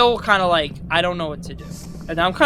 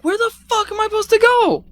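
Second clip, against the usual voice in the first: very high-pitched baffled squawk; exasperated. Retold or imitated. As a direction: retell it as a high, exasperated outburst. Acted exasperated outburst at the game.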